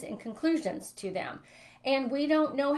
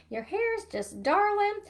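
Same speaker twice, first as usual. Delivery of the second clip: Southern lady; 11 semitones higher than usual. Retold or imitated. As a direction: coo it like a sweet Southern grandma. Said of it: "she did talk like that 'cause she was from, like, s-, the South".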